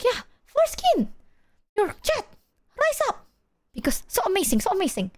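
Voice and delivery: high, proud mock mom voice; imitating mom. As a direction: gush like a clueless proud mom. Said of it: "It's like".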